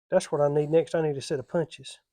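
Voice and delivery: normal talking voice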